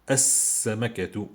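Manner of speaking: "As-Samakatu" with a long doubled s and no L; as-samakatu, hissing s held; L assimilated. Shown as a demonstration. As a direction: go straight into the s of "As-Samakatu". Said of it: "Je dis".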